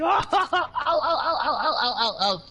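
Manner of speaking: high laughing ows; laughing mock ow. Cracking up while mocking the opponent, laughing ows. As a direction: squeal ow ow through laughter.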